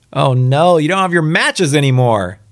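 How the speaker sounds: high mock lament; mock dismay. Reader teasing the man who dropped his matches, mock dismay.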